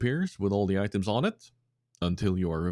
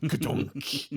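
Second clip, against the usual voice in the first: mock thud sound effect, a bit higher; clunky head bonk; 7 semitones higher than usual. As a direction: voice the dull clunk of a head hitting something. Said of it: "And then you hear in the background".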